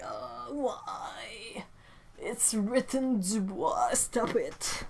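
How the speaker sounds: slow, hushed, breath-heavy groan of protest; mock despair. French speaker corrected on a French name, mock despair. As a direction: whimper "why" softly, then plead in drawn-out disbelief.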